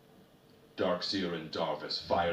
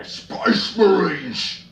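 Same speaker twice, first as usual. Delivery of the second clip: alarm shout; 14 semitones higher, 17 dB louder, much slower than usual. A narrator's reading. Orc alarm echoing down section.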